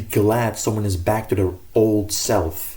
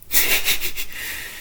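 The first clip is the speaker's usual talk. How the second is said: breathy giggle; 4 dB quieter than usual. Acted. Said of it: "that sounded weird".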